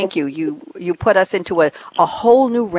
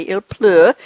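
il pleut in a French accent; il pleut said the French way, louder; 5 dB louder than usual. Shown as a demonstration. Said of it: "as the French say".

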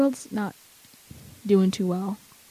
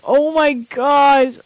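slow, drawn-out fangirl gush; re-enacted fangirl freak-out; 3 semitones higher, 4 dB louder, much slower than usual. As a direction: stretch it out, overwhelmed with excitement. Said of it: "like, freaking out".

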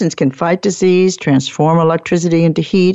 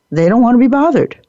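higher, fast, mock-impatient brush-off; brushed-off coworker; 7 semitones higher, faster than usual. Teacher imitates busy colleagues dismissing a request, mock-impatient. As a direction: toss it off like a busy colleague waving you away. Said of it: "When people say to you".

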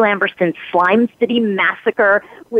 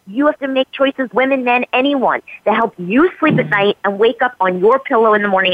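normal talking voice

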